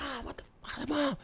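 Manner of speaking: mock yell. Storyteller acts out seeing his mom taken, hushed mock yell. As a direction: yell for Mom with the volume held down. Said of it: "I think I started yelling".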